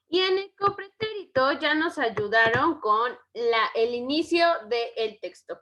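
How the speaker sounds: ordinary talk, nothing marked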